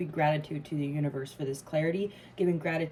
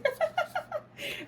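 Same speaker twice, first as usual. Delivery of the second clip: high giggle. Real, not acted. Overjoyed at the reading.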